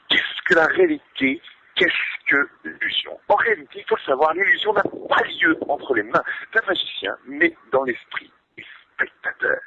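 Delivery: shrill, wild unhinged ranting, very high; crazy voice. Shown as a demonstration. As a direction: rant like a madman in a shrieking voice. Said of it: "une voix de fou".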